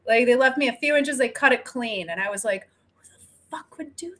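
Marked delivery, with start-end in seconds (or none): 2.9-4.1 unvoiced shocked whisper; whispering her disbelief in the dream. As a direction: breathe the outrage under the breath. Retold dream reaction, whispered.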